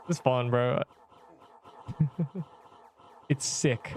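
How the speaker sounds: ordinary talk, nothing marked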